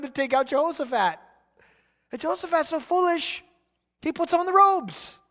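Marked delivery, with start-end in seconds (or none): none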